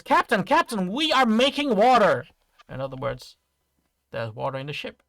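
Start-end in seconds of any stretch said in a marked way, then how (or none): 0.0-2.3 loud, high cry of alarm; panicked crew member. Acted. Crew member warns captain of flooding, loud panic. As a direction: raise the alarm to the captain, voice pitched up. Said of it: "he runs in panic and says".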